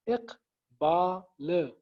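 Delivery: इकबाल drawn out slowly in three beats; slow syllable demo. Shown as a demonstration. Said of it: "तीन syllables हैं".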